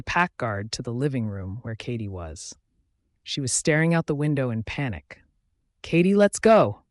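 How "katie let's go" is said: a raised urgent shout to get her moving. A narrator's reading. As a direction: call her away, urgent and loud. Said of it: "I shouted at her".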